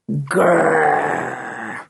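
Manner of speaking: long, drawn-out menacing dog growl. A narrator's reading. Timmy smelling his enemy Block, growling.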